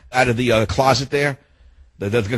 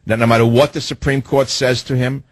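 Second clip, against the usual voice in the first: ordinary talk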